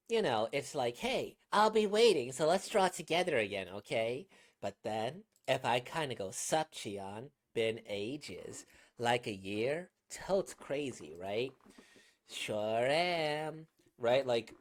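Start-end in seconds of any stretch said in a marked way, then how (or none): 1.0-4.3 put-on heavy Valley Girl, high and loud. Shown as a demonstration. Voice actor demonstrating a character's first manner of speaking, Valley Girl. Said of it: "you do that heavy Valley Girl accent". 6.3-13.7 put-on slow, drawn-out Valley Girl. Shown as a demonstration. Voice actor showing how the character changed, heavy Valley Girl. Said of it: "you do that heavy Valley Girl accent".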